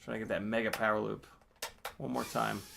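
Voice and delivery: soft, low aside to self; muttered resolve. Real, not acted. Pilot to himself before retrying the power loop, quiet mutter. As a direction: say it low and quiet, half to yourself.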